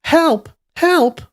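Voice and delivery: high-pitched, loud yell; yelled cry for help. A narrator's reading. Captive girl spots her friend and yells for help. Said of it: "had also seen him and yelled".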